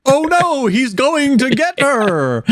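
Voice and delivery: high, drawn-out mock dismay; fake alarm. Mocking villagers' feigned alarm in film.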